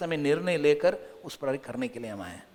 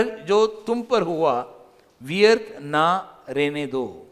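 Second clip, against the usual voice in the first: slow, louder, solemn verse reading; slow scripture reading; 4 semitones higher, 7 dB louder, much slower than usual. Acted. Preacher reads Corinthians to the congregation, slow and solemn. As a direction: read the verse out slowly with weight.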